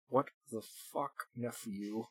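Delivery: fast, soft mutter through gritted teeth; muttered through clenched teeth. Acted. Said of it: "almost inaudibly through clenched teeth".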